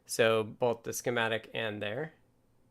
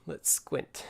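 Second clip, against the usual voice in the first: breathy aside, barely voiced; whispering; 3 semitones higher, 4 dB quieter than usual. Real, not acted. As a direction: mutter it to yourself. Muttering to self at the screen.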